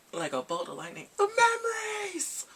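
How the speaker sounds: high, loud, drawn-out dramatic cry. Real, not acted. Describing memories flooding back, dramatic slow cry. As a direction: belt it like a movie climax.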